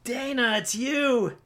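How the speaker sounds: a light Ghostbusters line reading; movie quote. Pretend. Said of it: "That's a quote from Ghostbusters".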